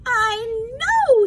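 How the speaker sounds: a bright hurried yes, mom; Froggy voice. Acted. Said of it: "said Froggy".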